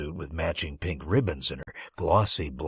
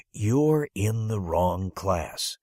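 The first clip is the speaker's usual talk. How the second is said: very slow, deliberate; slow verdict; much slower than usual. Acted. Teacher telling the boy he's in the wrong class, slow. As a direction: spell out the bad news slowly.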